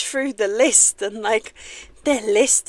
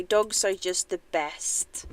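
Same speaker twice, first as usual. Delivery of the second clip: usual speaking voice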